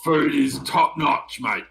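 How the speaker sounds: half orc doorman. Acted. Orc doorman answers the noble guest, loud and casual. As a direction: brush them off, blokey and loud.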